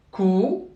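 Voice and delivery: "ku" with a long held oo; long vowel. Shown as a demonstration. Teacher showing kaf followed by waw, "ku" held long. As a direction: draw out the vowel of "ku". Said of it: "suivie d'une voyelle longue".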